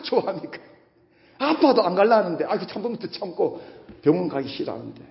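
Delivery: ordinary talk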